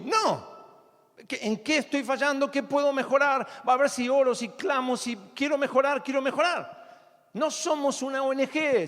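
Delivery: loud, high-pitched pulpit exhortation; fiery preaching. Acted. Preacher urges the congregation loudly to examine their failings. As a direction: preach it with rising fervor.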